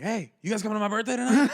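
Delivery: high, fast, laughing invite to the party; excited invite. Retold or imitated. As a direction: blurt it out with a laugh, too eager. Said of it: "you're like".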